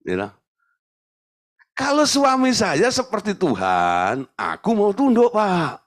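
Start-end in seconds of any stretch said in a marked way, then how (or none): 1.7-5.8 high mock-whiny voice of a reluctant wife; imitating a complaining wife. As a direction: mimic the wife bargaining with the pastor. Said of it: "Banyak perempuan tuh ngomongnya begitu".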